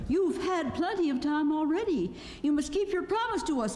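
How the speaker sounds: high-pitched indignant exclamation; Dorothy. A narrator's reading. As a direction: demand it in a high girl's voice. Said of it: "claimed Dorothy".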